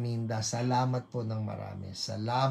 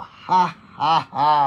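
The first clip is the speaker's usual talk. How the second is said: laughing at trouble; 3 semitones higher, 10 dB louder, slower than usual. Shown as a demonstration. Preacher laughing at each affliction named, loud deliberate laugh. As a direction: punch out a forced, defiant laugh. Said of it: "Tawanan ninyo".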